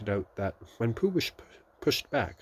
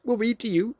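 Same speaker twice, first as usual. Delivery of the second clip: bright, higher and louder; cheerful; 7 dB louder than usual. A narrator's reading. Rabbit offering to read to stuck Pooh, cheerful. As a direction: chirp it helpfully. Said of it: "said Rabbit cheerfully".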